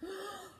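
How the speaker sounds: soft, high gasp; startled gasp. A narrator's reading. Reader reacts before the big bang, startled gasp. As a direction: suck in breath in alarm.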